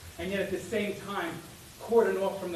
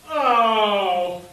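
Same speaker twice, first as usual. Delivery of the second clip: loud startled yelp; startled cry; 10 dB louder than usual. Acted. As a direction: cry out in sudden alarm. Predators get out.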